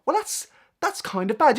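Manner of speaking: high, breathy little mock gasp, then a slow let-down; sarcastically underwhelmed, like a viewer shrugging at a title; mock mild reaction. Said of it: "read a title and be like".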